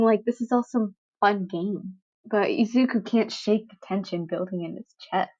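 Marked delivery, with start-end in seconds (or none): none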